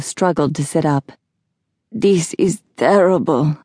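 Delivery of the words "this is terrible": sickly put-on voice; queasy. Sick Laszlo struggles to sit up, queasy. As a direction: groan it out like a nauseous patient.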